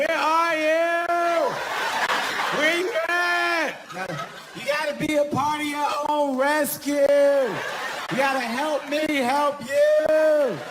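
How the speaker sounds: high, slow, drawn-out taunting calls; mock rescuer. Teasing his wife in the water, slow high mock calls. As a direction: stretch each word out like a smug lifeguard. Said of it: "I'm like".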